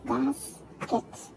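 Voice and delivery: "basket" split by a gap, bas, ket; syllable by syllable. Shown as a demonstration. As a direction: break "basket" into two separate beats. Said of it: "two syllable".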